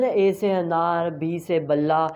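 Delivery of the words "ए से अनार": ordinary delivery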